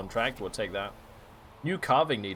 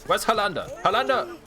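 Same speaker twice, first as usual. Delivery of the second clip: loud, much higher call across the village; 8 semitones higher, 4 dB louder than usual. Real, not acted. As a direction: shout the name to find her. Calling out for someone.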